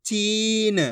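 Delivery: "चीन" with a long held ee; slow, elongated "चीन" for learners; drawn-out pronunciation. Shown as a demonstration. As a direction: stretch the vowel in "चीन" right out. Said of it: "आपको खींच के पढ़ना है".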